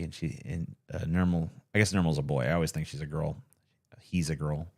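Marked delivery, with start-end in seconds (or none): 4.0-4.7 whisper. Real, not acted. Whispered aside.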